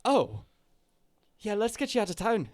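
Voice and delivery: rushing her friend out, in Cockney; Cockney accent. Acted. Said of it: "I keep switching accents".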